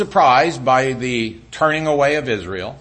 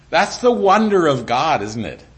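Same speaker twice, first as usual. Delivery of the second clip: normal talking voice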